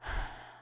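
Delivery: low-key, quiet sigh; soft sigh. Acted. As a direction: let out a short, soft breath. Pauses before reassuring amnesiac kid.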